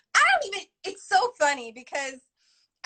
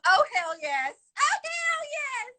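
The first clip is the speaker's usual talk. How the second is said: loud, drawn-out gleeful exclamation; catchphrase shout; 10 semitones higher, 4 dB louder, slower than usual. Acted. Player shouting a remembered catchphrase, exuberant. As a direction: belt it out higher the second time.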